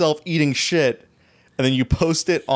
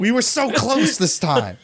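mock villain lament; 8 semitones higher than usual. Villains lament foiled plan.

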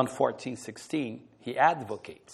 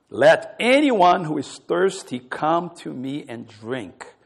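loud, drawn-out proclamation; 9 dB louder, slower than usual. Acted. Jesus calls to the thirsty on the feast's last day, loud voice. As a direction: proclaim it loudly, stretching the key words. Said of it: "said in a loud voice".

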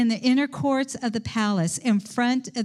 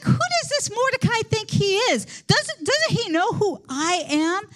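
a mildly voiced indignant official, much higher; angry Haman; 7 semitones higher than usual. Preacher voicing angry Haman over Mordecai, pitched up. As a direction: huff it out as the offended villain. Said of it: "Haman got so angry".

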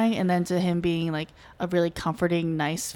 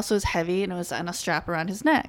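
ordinary delivery

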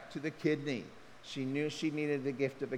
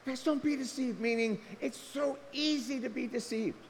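pleading, much higher, urgent; impassioned appeal; 11 semitones higher than usual. Shown as a demonstration. Pastor pleads with his church, impassioned. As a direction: pastor urging the congregation not to be fooled. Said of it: "that's a passionate appeal".

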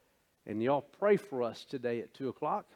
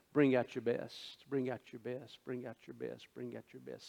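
fading challenge; 6 dB quieter than usual. Acted. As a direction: repeat it softer each time, like a distant echo. Goliath's challenge repeated, fading away.

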